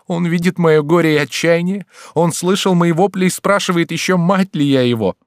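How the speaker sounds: high, loud outcry of wounded mother; anguished exclamation. A narrator's reading. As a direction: cry out, indignant and distraught. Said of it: "вскричала Андреа". (возмущённый, отчаянный вскрик)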